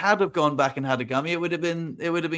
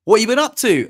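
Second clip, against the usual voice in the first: high, loud and keen; eager question; 6 semitones higher, 7 dB louder than usual. Retold or imitated. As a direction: ask it brightly and eagerly. People asking at wedding.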